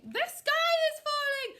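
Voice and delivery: loud, high panicked shout; squawking birds in full alarm. A narrator's reading. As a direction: shout it while charging along.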